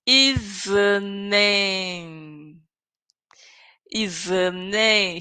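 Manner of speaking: "Is a name" stretched very slowly, sounds connected; slow linking drill. Shown as a demonstration. Teacher drilling linked sounds for students, very slow. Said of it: "We connect everything".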